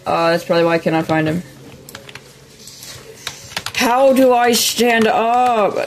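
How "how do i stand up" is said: slow, higher-pitched exasperation; exasperated. Real, not acted. Gamer stuck unable to stand up, exasperated. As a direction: drag the words out, pitch raised in frustration. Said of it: "This is pissing me".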